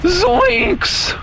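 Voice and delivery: very high cracking zoinks; Shaggy voice. Retold or imitated. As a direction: squeak out a cartoon zoinks. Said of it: "what is my voice crack doing?".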